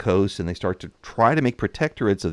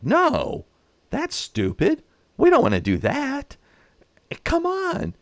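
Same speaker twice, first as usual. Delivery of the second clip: much higher, incredulous mock voice; scoffing neighbours; slower than usual. Neighbouring countries rejecting France's border claim, in a high scoffing voice. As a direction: brush the idea off in a high, disbelieving squeak. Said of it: "other countries of the area were going like".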